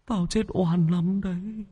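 high plaintive ghost wail. Acted. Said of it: "cất giọng ồm ồm".

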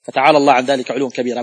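ordinary talk

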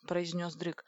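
ordinary delivery

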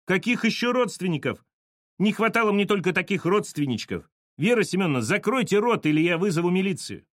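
high-pitched indignant tirade; enraged. A narrator's reading. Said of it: "взбешённая Саша".

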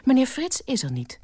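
high, firm statement; firm high-voiced housekeeper. A narrator's reading. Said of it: "ditmaal ferm".

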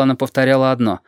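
plain reading voice

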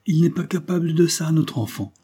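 vive exclamation de mère, plus haute; s'écrier. A narrator's reading. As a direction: proteste pour défendre son fils. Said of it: "s'écria la réveillère". (s'écrie, voix plus haute)

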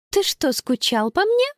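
high-pitched, lightly voiced girl; teasing girl. A narrator's reading. Girl to little brother hugging her, lightly teasing. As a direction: lift the pitch to tease.

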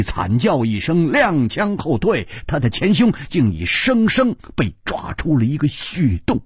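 ordinary delivery

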